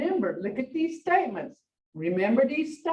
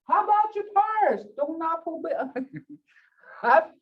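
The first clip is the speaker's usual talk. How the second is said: husband's question; 7 semitones higher than usual. Retold or imitated. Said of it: "my husband is saying".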